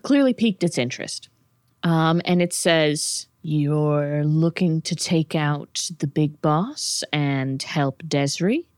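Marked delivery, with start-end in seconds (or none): 3.4-8.7 slow, measured demon voice; lightly voiced, deliberate fiend; probing demon. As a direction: draw the question out as a scheming devil. Game master voices the demon.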